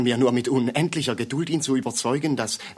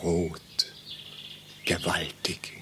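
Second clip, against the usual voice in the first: awed hush; 6 semitones lower, 6 dB quieter, slower than usual. Acted. Awed at sunset.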